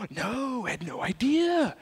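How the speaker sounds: whispered, high fake surprise; mock innocence. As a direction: play dumb, breathy and high. Mock-innocent suspect reply.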